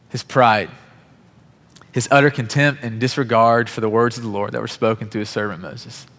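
ordinary delivery

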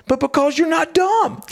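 high mock shout of a stand-up; retold comedian. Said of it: "he's a comedian".

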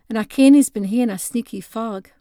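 plain reading voice

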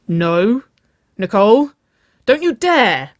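loud, high alarmed warning. A narrator's reading. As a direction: warn them off, rising to a cry. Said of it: "Waverley warned".

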